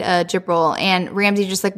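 normal talking voice

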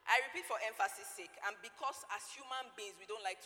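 loud emphatic pulpit repeat. Acted. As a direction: drive the point home. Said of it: "I'm repeating it for emphasis".

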